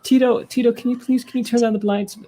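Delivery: high-pitched put-on pop star voice. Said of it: "he's mixing and he's like".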